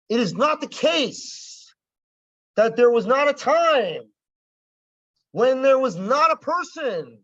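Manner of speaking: mock a politician trying to sound fancy; fancy politician talk. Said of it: "they just talk like this to sound fancy".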